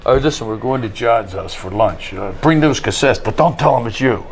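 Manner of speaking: low mumbling Stallone imitation. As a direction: drop low and mumble like Sly. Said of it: "He goes".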